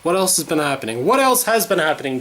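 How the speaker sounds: normal talking voice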